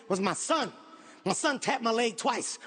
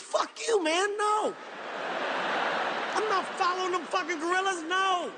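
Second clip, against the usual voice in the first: retold outburst; 5 semitones higher than usual. Refusing to follow the gorillas, shouting at the guide. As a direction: bark it out, pitch way up.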